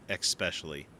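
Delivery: "especially" said with an X, as "expecially"; mispronounced as "expecially". Shown as a demonstration. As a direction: put a K sound into "especially": "ek-specially". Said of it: "Did you say especially".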